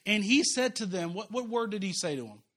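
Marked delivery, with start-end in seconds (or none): none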